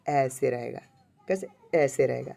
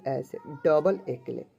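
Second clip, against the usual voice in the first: ordinary talk, nothing marked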